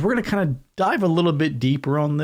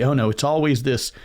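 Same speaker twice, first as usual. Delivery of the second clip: ordinary talk, nothing marked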